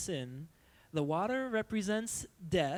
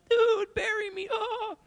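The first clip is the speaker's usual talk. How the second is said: high squeaky cartoon fish voice; 5 dB louder, slower than usual. Youth pastor voicing the fish in a high squeak. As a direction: pitch way up like a little cartoon fish.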